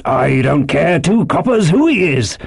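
high, forceful landlord's shout; indignant sailor calling across a room; crying out. A narrator's reading. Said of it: "cried Silver".

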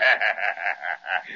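hearty pirate laugh, low. Acted.

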